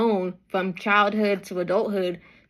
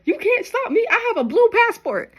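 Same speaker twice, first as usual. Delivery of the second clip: higher, hammed-up bragging; mock boastful citizen; 11 semitones higher than usual. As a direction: brag in a cheeky, higher voice. Imitating boastful Roman citizen.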